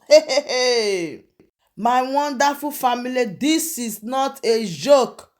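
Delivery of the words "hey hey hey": laughing exclamation. Real, not acted. Reacting to alarming news headline.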